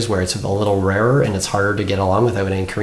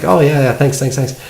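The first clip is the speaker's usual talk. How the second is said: higher-pitched, repeated mock thanks; imitated grateful coworker; 5 semitones higher, 3 dB louder than usual. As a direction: act out thanking someone on a friend's behalf. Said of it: "he was thanking her for me".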